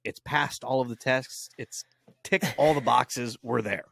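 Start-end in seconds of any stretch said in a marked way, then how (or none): none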